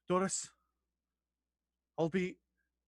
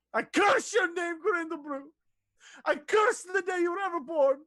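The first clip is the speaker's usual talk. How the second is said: cursing shout; 10 semitones higher than usual. Acted. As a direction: spit out the curse at him. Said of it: "And she spits on the ground".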